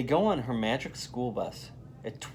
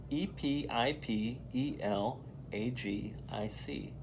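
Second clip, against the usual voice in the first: spelling out letters; 7 dB quieter than usual. Shown as a demonstration. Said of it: "Let's sound it out".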